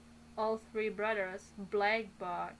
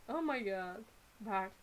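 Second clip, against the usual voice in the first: usual speaking voice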